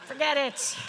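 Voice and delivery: normal talking voice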